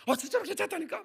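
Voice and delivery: high imitated insistence; mock insistent girl. Niece insisting to her uncle that she is fine. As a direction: insist like an exasperated teen.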